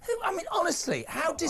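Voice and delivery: high, spluttering indignation; hammed-up offence on a colleague's behalf; mock outrage. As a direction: splutter in feigned disbelief, pitched right up. Host feigns outrage for the broadcaster.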